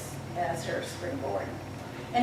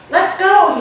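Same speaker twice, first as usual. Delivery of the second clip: excited invite; 10 semitones higher, 13 dB louder than usual. Acted. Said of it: "laughing with delight".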